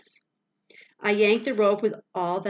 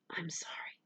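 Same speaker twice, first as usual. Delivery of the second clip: much softer, breathless whispered apology; tearful whisper; 13 dB quieter than usual. A narrator's reading. Boy hugging his dog, whispered tearful apology. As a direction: whisper it through held-back tears.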